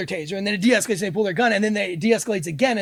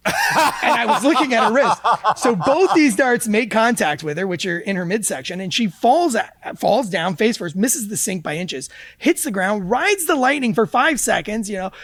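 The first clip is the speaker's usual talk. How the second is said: ordinary talk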